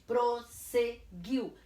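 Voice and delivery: "prosseguiu" slow, each syllable apart. Shown as a demonstration. Teacher reviews the double S, "prosseguiu" syllable by syllable. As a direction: break "prosseguiu" into three pieces. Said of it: "cada um numa sílaba".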